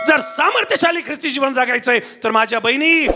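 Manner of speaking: ordinary talk, nothing marked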